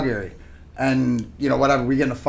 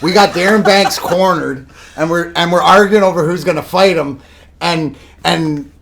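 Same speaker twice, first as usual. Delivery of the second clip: normal talking voice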